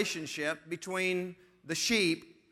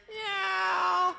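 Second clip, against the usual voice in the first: shouted squeaky cat meow; 12 semitones higher than usual. Retold or imitated. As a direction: belt out a cartoon cat meow. Cat sound in toy game.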